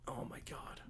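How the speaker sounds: whispered. Real, not acted. After the bit ends.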